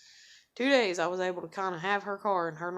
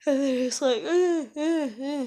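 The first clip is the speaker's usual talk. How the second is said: mock whimper; 5 semitones higher, 7 dB louder than usual. Mocking family's worried whining.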